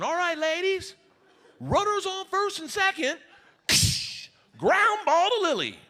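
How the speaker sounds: high, projected coach voice; coach calling out. Acted. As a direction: call the play across the ball field. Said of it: "Coach is like".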